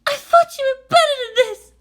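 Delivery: put-on high, tearful young woman's voice; sobbing. Maya sobbing her disappointment at Shinji. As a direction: choke it out through tears. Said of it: "She sobbed".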